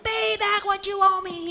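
high, loud demand; demanding shout. A narrator's reading. Servant throttling debtor, loud high demand. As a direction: yell the debt at him. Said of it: "he demanded".

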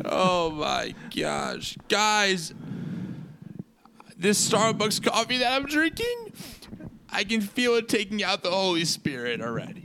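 a horrendous mock weep, pitch cracking upward; fake cry. Host fake-crying to his co-hosts over Starbucks, hammy sobbing. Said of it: "Your fake cry is horrendous".

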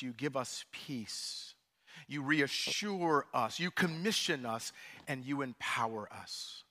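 earnest, measured pastoral prayer. Acted. Leads congregational prayer.

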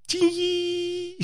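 high, held electronic squeal, done by mouth; imitated dial-up modem. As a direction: mimic a modem's long high whine. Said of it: "That sounds like the dial-up modem".

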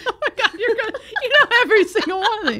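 high, fast laughing delivery; real laughter. Real, not acted. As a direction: laugh it out quickly. Laughing at friend.

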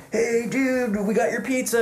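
high, goofy surfer dude; hammed-up Ninja Turtle voice. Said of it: "the Ninja Turtles are like".

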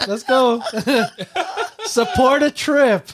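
giggling through the words; laughing. Real, not acted. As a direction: laugh while begging playfully. Laughing plea for donations.

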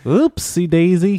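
high slow silly voice; sing-song it, slowly. Acted. Silly put-on phrase.

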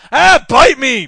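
loud, high brush-off; scornful retort. Acted. Baggy answers being corrected, loud and scornful. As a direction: throw it back loudly at a critic. Baggy snaps back at Patty.

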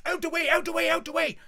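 high, loud repeated command; urgent shouting. Retold or imitated. Lads marching through a crowd, urgent shouting. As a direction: clear a path with your voice.

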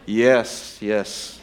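low, half-listening murmurs of assent; distracted, low-pitched yeses; absent-minded agreement. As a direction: agree vaguely while attention is elsewhere. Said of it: "I've learned the art of saying".

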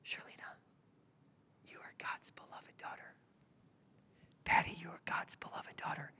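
soft, breathy whisper of God's voice; tender unvoiced whisper into the ear. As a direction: whisper it close, like a secret blessing. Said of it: "That's what the Spirit is whispering".

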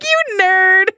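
high, loud mock-insult; teasing outburst. Teasing date about the Pokemon movie, mock-insulting outburst. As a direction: blurt it out as a playful jeer.

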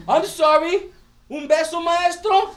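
put-on high little boy's voice, apologizing and asking for a kiss; sweet child. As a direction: coax the teacher like a sorry little boy.

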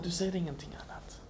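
whispered softly, unvoiced. Shown as a demonstration. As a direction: let it fall to a whisper. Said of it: "whispered repetition".

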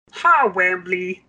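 slow, high puppet voice; loud, drawn-out character greeting; Boober Fraggle impression. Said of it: "That was my Booba".

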